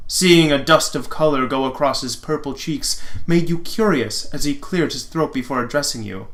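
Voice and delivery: ordinary talk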